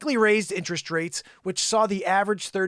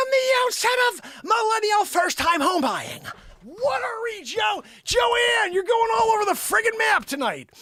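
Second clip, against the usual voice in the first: much higher, louder manic Carrey; Jim Carrey impression; 14 semitones higher, 4 dB louder than usual. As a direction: shout it high like Jim Carrey. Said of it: "Jim Carrey comes out of the blue".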